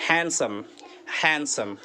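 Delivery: Handsome as "HAN-sum", second syllable falling away; stress on first syllable. Shown as a demonstration. Teacher points learners to the stress mark, first-syllable stress on handsome. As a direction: lean on the start of handsome, no D. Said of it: "क्या ये stress देख लें".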